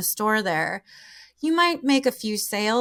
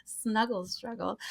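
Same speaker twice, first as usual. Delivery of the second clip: softly, as if to oneself; quiet repeat; 8 dB quieter than usual. Real, not acted. Writing these words down, quietly repeating one. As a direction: repeat it under the laughter, much softer. Repeats term to self, noting it down.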